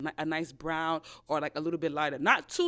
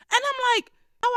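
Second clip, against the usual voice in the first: surprised; 7 dB louder than usual. Real, not acted. Exclaiming about drama.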